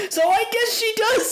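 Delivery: very high squeal of disbelief; exaggerated high-pitched incredulity; comic exclamation. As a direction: squeak it out in mock realization. Comic exclamation about the show.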